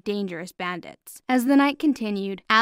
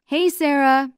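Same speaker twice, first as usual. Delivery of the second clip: loud, lifted hail; shouted greeting; 6 semitones higher, 7 dB louder than usual. A narrator's reading. Brian to Sarah as she gets inside, shouted. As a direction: call out to her, higher and louder. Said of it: "Brian shouted".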